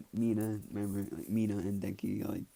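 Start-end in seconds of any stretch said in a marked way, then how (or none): none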